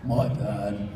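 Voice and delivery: normal talking voice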